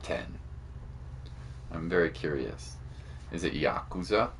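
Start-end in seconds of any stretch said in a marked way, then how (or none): none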